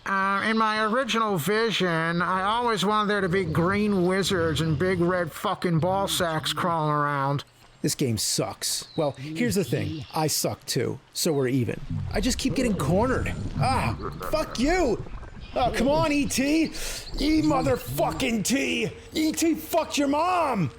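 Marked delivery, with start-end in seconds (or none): 0.0-7.5 put-on interviewee voice; mock a director musing on his vision; Spielberg. Film director explaining his vision in an interview, mock impression. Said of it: "an interview where he said". 13.6-20.7 high-pitched, drawn-out exasperated rage; furious cursing. Real, not acted. Cornered player swearing at E T, furious.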